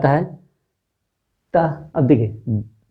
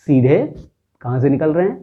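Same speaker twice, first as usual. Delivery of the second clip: ordinary talk, nothing marked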